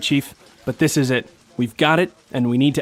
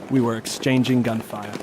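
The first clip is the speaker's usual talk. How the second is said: ordinary delivery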